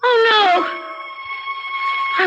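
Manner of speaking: a very high, crying shout of horror; terrified cry. Acted. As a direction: cry out in horror at what you've done. Realizes she shot at husband's bed.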